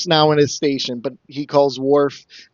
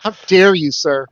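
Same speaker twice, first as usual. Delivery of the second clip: hammed-up outrage, a bit louder; mock indignation; 3 semitones higher, 4 dB louder than usual. Host pretends offense at his co-host's joke, mock indignant. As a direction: play the offended gentleman, tongue in cheek.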